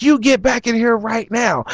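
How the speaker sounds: put-on high, demanding drunk voice; mock angry demand. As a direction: order him back inside. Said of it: "he's like".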